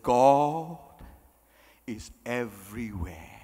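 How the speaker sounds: deep, hushed Phantom of the Opera tone; low reverent God voice. Retold or imitated. Mother answering her son about God in a deep reverent voice. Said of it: "their voice goes very low".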